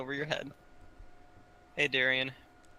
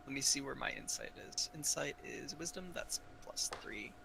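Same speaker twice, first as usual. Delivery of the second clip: whisper; 10 dB quieter than usual. Real, not acted. Player mutters over character sheet.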